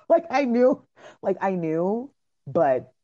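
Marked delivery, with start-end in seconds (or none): none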